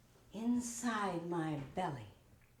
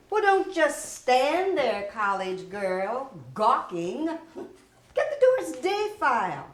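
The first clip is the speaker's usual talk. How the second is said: bossy shout; 2 semitones higher, 9 dB louder than usual. Acted. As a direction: bark it at the gawking new girl. Boss barks orders at assistant.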